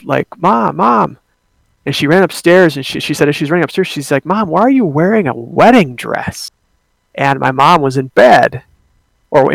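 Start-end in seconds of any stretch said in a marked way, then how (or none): 0.4-1.2 retold yell. Sister yelling for her mom, higher mock call. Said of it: "she yelled". 4.2-6.5 higher, singsong questioning call; imitated puzzled sister. Sister running upstairs to mom, higher puzzled call.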